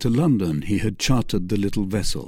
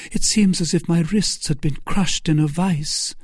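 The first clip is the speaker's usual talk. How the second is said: high-pitched Milady voice; complaining Milady; 8 semitones higher than usual. Acted. Milady telling Felton of her hurt wrists, high-pitched. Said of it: "asked Milady".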